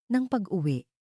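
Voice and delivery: usual speaking voice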